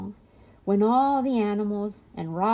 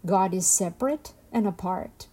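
usual speaking voice

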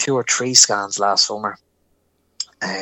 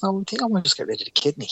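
quick, higher-pitched offhand doctor; nonchalant, casual; 9 semitones higher, 3 dB quieter, faster than usual. Retold or imitated. Consultant correcting patient about the kidney, breezily nonchalant. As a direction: rattle it off as no big deal. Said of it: "the most nonchalant, casual way".

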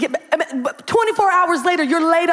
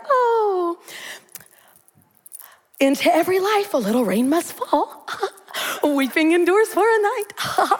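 pious super saint; 2 semitones higher than usual. Retold or imitated. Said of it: "the super saints would say".

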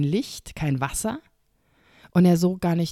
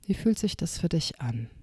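guided meditation. Shown as a demonstration. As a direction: lead a gentle inner journey. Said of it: "eine kleine Meditationsübung mit dir machen".

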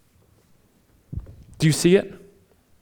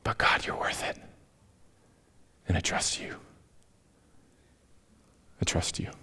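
hushed, voiceless words of trust; whispered prayer; 11 semitones lower, 14 dB quieter than usual. Retold or imitated.

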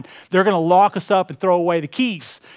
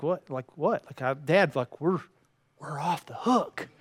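hushed retold reply; 4 semitones lower, 9 dB quieter than usual. Son's baffled reply to angry dad.

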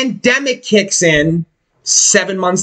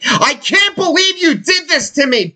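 mock scream; 8 semitones higher than usual. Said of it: "she's screaming at me".

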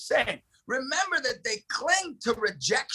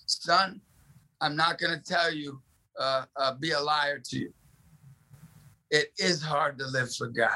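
solemn fatherly; 8 semitones lower than usual. Retold or imitated. Father admits a hard truth to his son, solemn and low. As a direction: drop low, like confessing a hard truth to a child.

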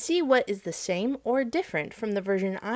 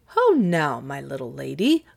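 lightly voiced father calling out, louder at the start; crying out; 5 semitones lower, 4 dB louder than usual. A narrator's reading. King Midas greets his tearful little daughter, crying out. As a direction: call out to a child with a mild cry. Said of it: "cried Midas".

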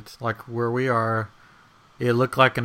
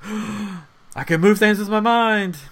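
mock awe; 8 semitones higher, 3 dB louder, slower than usual.

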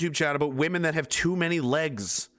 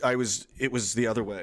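ordinary talk

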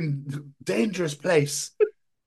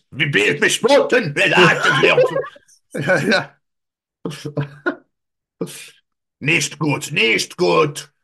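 mock German dog; 4 semitones higher, 5 dB louder, slower than usual. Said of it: "you get a fucking German Shepherd".

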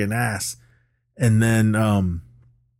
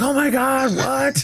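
high mock gasp of disbelief; shocked; slower than usual. The girls see Martha is Matthew, shocked high squeal. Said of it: "all the girls are shocked".

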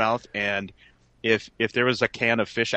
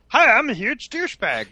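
high mocking voice; mock douchebag voice; 12 semitones higher, 3 dB louder than usual. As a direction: squeak it out as a douchebag. Mocking a t-shirt wearer.

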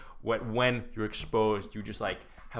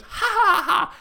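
high, loud evil laugh; witch's cackle; 5 dB louder than usual. Acted. Witch getting out of the painting, gloating cackle. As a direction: cackle like a hammed-up villain.

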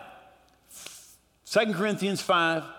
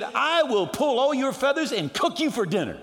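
raised-pitch angry threat; threatening manager; 7 semitones higher than usual. Acted. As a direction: threaten the bird emphatically. Acted manager threatens the parrot.

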